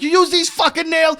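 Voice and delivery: high incredulous yell; mock angry grandpa. Grandfather finds nails in his tree, mock yell. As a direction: shout it up high like an outraged grandpa. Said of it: "He's like".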